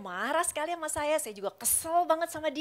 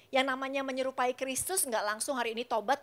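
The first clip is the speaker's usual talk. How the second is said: ordinary talk, nothing marked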